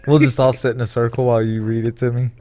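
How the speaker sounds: chuckling through the line; laughing tease. Real, not acted. Teasing his friend about story time, laughing. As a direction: laugh while you rib him.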